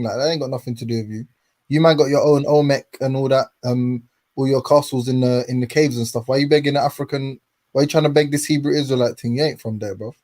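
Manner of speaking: normal talking voice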